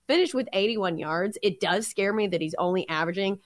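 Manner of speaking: ordinary talk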